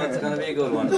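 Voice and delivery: normal talking voice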